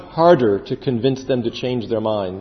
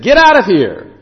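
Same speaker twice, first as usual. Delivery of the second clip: louder, higher burst of dismissal; exasperated royal order; 5 semitones higher, 7 dB louder than usual. Acted. King at the end sending Moses away, exasperated outburst. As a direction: throw him out with one forceful command.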